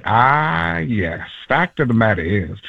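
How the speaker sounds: drawn-out opener dropping into a deep voice; Morgan Freeman. Shown as a demonstration. Comedian demonstrating an impression, low voice. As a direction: play with it, sinking low. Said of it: "then you learn to play with it".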